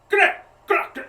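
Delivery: creature chatter. Acted. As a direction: yap out guttural nonsense syllables. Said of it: "they look to each other and they go".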